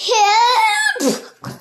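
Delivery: high, loud panicked shriek; screamed help. A narrator's reading. Mrs Twit screaming at the frog in her bed, terrified shriek. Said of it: "screamed Missus Twit".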